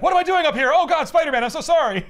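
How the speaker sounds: high, loud, laughing apology; flustered apologetic Lizard. Retold or imitated. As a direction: gush out sorry like a panicked monster. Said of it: "he's like".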